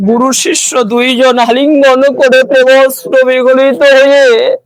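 swelling, high, loud narration; fervent climax. A narrator's reading. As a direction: build to an impassioned devotional peak. Emotional climax of devotional story.

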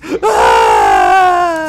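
long, high, loud scream; mock cartoon scream. Imitating Bumby's mom from Animaniacs, loud high scream. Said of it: "Bumby's mom, she's".

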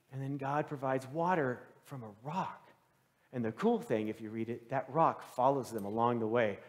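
normal talking voice

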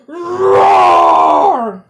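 huge, very loud roar; most ferocious roar. A narrator's reading. Lion pupil roars at the dog, ferocious full roar. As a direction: let out a ferocious lion's roar. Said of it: "the most ferocious roar".

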